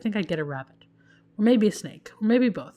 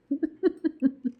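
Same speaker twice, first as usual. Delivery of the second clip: amused laugh; 8 semitones higher than usual. Real, not acted. Player laughs at kids' message.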